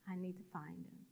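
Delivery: low, very soft insistence; quiet desperate plea. Retold or imitated. Patient leaving the table in search of God, hushed plea.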